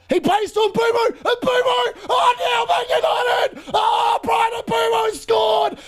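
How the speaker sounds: high-pitched, roaring play-by-play of a goal; excited goal commentary. Real, not acted. Watchalong host calls United's opening goal to viewers, excited commentary. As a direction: build to a high scream on the goal, then ease down.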